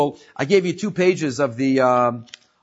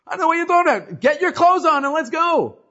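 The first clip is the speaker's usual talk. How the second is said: much higher, louder mock outrage; brazen indignation; 13 semitones higher, 4 dB louder than usual. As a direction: bluff it out, ordering the boss around. Acted quarrel.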